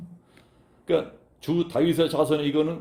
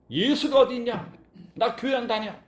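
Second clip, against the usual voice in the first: mock indignant outburst; 8 semitones higher than usual. Frustrated believer voiced.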